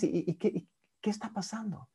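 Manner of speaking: whispered, hesitant curious prompt; hushed therapist probing. Shown as a demonstration. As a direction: whisper as if sharing a secret. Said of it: "esto hago mucho".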